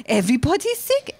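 mocking accented echo with a sharp questioning rise; incredulous mother. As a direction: parrot it back as a disbelieving mother. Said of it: "She's like".

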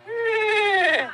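very high, loud squealing whinny; whinnying for the kids; horse whinny. Retold or imitated. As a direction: neigh shrilly like a horse. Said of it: "And a horse goes".

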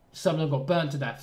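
usual speaking voice

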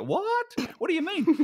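retelling his confused reply at the pub, mock-shrill surprise; retold shock. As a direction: replay your own baffled What? way up high. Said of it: "I go".

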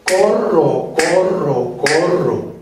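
"Corro" silabeado en cadencia regular; co-rro repartido en dos mitades iguales del tiempo; un pulso cada una. Shown as a demonstration. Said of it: "en un pulso". (recitado rítmico de corcheas)

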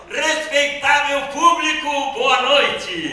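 loud, high ringmaster bellow; ringmaster shout. Shown as a demonstration. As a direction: belt it out to the big top. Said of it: "mais forte".

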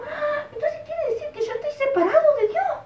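put-on worried boy. Boy realizes he is separated.

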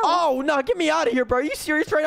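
high, frantic pleading; mock panic. Gamer trapped with the sisters, panicking. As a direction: beg to be let out, hammed-up.

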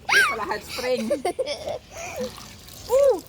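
cold yelps. Real, not acted. Dad in cold pool, yelping.

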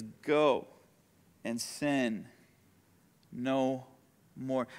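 soft, low, word by word; gentle command. Acted. Jesus sending the pardoned woman away, soft, low, gentle. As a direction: release them quietly, pausing between words.

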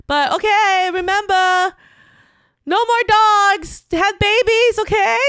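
put-on high, loud, slow drunk-mom toast; imitating tipsy mom. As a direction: ham up the tipsy mother's speech. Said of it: "And it's my mom".

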